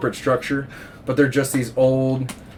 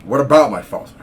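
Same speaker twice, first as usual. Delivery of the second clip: lightly voiced defensive patient, loud at first; Connery; 2 semitones higher, 5 dB louder than usual. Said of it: "Connery is struggling with his sexuality".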